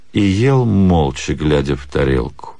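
ordinary delivery

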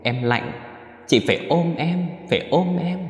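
put-on high, pleading little-girl ghost voice; eerie child begging to be held. A narrator's reading. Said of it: "tiếng cười khanh khách của con nít".

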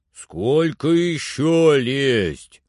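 slow, drawn-out distant call; voice from afar. A narrator's reading. Servant calls from the treetop, slow distant shout. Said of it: "как будто издалека".

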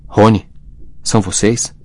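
plain reading voice

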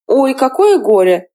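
slow, mock pity with a sneer. A narrator's reading. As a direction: draw it out, faking sympathy. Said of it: "со злой усмешкой". (насмешливо, с притворным сочувствием)

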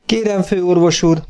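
lightly voiced confident patient; bold and frank. A narrator's reading. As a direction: speak up boldly and openly. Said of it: "bátran és nyíltan".

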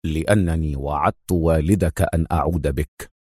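low, stern explanation. A narrator's reading. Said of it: "أجابه أدهم في صرامة".

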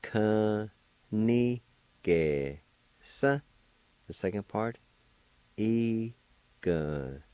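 "Ka, ni, ge, sa" each syllable set apart; careful syllable demo. Shown as a demonstration. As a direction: teach each piece slowly. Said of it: "first part".

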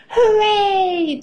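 high, drawn-out gleeful cheer. A narrator's reading. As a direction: let out a child's high hooray. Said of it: "cheers Peppa".

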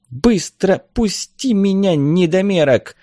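high, slow, indignant demand; angry cry. A narrator's reading. Woman struggling free from Vagar, angry. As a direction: raise the pitch in outrage, without shouting. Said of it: "сердито крикнула женщина".

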